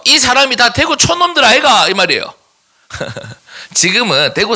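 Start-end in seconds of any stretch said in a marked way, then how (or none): 0.0-1.9 mock Gyeongsang country-bumpkin line, pitched up; 대구 사투리로 놀리듯이; Daegu dialect. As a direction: tease in a Daegu accent, higher. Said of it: "이게 무슨 말인지 아세요?".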